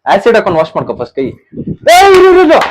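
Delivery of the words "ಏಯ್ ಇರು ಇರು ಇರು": high, loud, startled yelp of wait; startled cry. Real, not acted. Brother suddenly scared during the game, high startled yell. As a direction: jump back crying out to stop.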